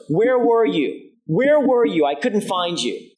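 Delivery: high, loud, slow accusing cry. Retold or imitated. Man accusing woman he thought had left, imitated high angry cry. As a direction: hammer the question at her in a retold rage. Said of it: "He accuses her".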